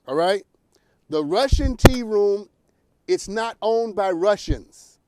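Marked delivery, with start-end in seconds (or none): 1.1-5.0 slow, every word weighed; slow emphatic. Shown as a demonstration. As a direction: spell it out slowly for New Yorkers. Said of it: "please hear me".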